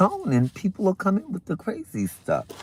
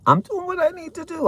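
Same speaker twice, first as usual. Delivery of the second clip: mock quote; 14 semitones higher, 5 dB quieter, faster than usual. Mocking a commenter's phrase.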